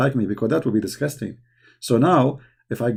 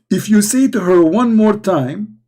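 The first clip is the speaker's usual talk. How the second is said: slow, raised-pitch warning; mock warning; 6 semitones higher, 4 dB louder, slower than usual. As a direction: build up slowly to a threat. Retells the wife's threat.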